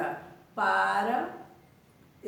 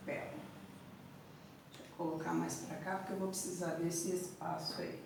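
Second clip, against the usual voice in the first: soft whisper to herself; teacher whispering to herself while rearranging; whispered aside; 5 semitones lower, 13 dB quieter than usual. Real, not acted. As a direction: mutter the aside under your breath, a bit quick. Aside while arranging the board.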